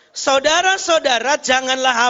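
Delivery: loud, high proclamation of the verse; preaching scripture reading. Real, not acted. Preacher reads the verse with the congregation, loudly proclaiming. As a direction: read it out forcefully for all to follow.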